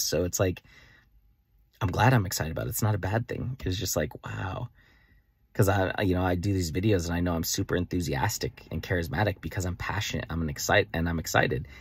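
ordinary delivery

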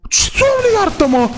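very high exclaim of Doamne; high startled yelp; shocked cry. Acted. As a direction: cry out in alarm. Shocked at the discovery.

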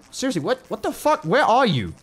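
high-pitched, exasperated questioning; startled, pitch jumping up; baffled alarm. Real, not acted. Gamer hunting an unseen noise, baffled and alarmed.

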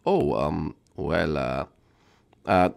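deep quiet aside; low awkward aside. Acted. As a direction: apologise under your breath. Voicing Cappy, embarrassed aside.

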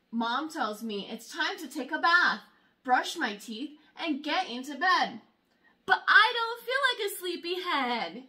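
usual speaking voice

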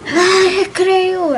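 high, loud and very slow whine; whiny groan. Real, not acted. Girl reacting to the word she must draw, drawn-out whine. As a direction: drag it out, dreading the word.

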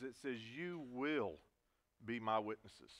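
"It says you will" slowed right down, weight on "will"; stress on WILL. Shown as a demonstration. Pastor driving home the word will, slow and pressed. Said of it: "underline that word will".

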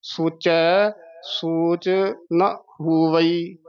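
ਸੋਚੈ, ਸੋਚ, ਨਾ ਹੋਵਈ with gaps; word by word. Shown as a demonstration. Teacher modelling the whole line, words spaced apart. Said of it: "ਇਕੱਠਾ ਨਹੀਂ ਕਹਿ ਦੇਣਾ".

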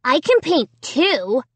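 high, whiny little-brother voice; young boy's retort. A narrator's reading. Little brother defending himself to sister, defiant. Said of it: "Jed replied".

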